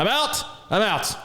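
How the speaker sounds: high-pitched dramatic bail-out; mock injured cry. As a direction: call it out high, as if just hurt. Acted injured player quitting.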